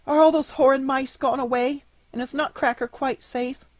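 usual speaking voice

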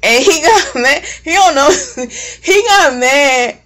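real giggles breaking into slow, high words; amused laughing. Real, not acted. Laughs while starting her story.

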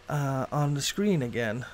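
normal talking voice

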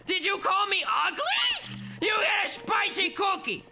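high, loud, slow indignant shout; hammed-up offended yell; mock outrage. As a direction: play up being insulted. Mock outrage at being called ugly.

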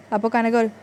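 ordinary delivery

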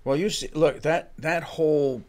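ordinary talk

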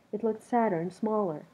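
usual speaking voice

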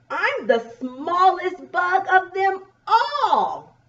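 high, slow little bug voice; drawn-out, high storybook boast; smallest bug. Acted. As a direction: crow it as the tiniest bug. Reads smallest bug's boast.

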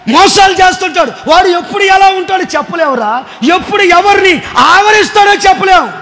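high, rising rebuke building to a shout; voice of God's word. Retold or imitated. God's word rebuking the young brother, rising stern rebuke. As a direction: scold like God correcting a child. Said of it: "అని అంటుంది దేవుని వాక్యం".

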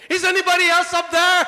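loud, high panicked call; desperate yell for help. Acted. Man on the branch begging anyone but God, loud and high. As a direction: shout upward, begging someone else to answer. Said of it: "He looks up again".

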